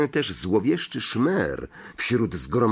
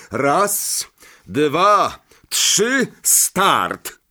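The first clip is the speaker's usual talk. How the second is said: rising count, louder and higher; shouted countdown; 6 semitones higher, 5 dB louder, much slower than usual. A narrator's reading. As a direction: call out the start of a duel. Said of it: "krzyknął".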